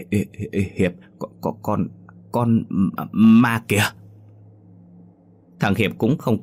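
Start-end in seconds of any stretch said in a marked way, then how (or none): 0.1-3.8 trembling, word by word. A narrator's reading. Tuấn pointing out a ghost to his friend, stammering. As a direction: stutter in terror, one syllable at a time. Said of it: "lẩy bẩy nói từng tiếng như tập nói".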